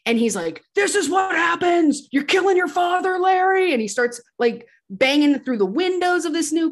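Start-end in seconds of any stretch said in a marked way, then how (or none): 0.7-3.7 unhinged, high-pitched mock shouting; Walter. Walter attacks the car in front of Larry, unhinged mock shout. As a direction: rant at the top of a raised voice, imitating someone losing it. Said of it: "Walter loses his mind, becomes unhinged".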